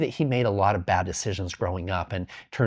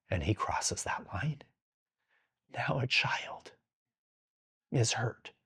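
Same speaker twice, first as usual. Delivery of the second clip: hushed, unvoiced dramatic whisper; grim whisper; 8 dB quieter, slower than usual. Telling listeners the child gets hurt: grave whisper. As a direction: drop to a whisper for the worst part. Grim climax of his example.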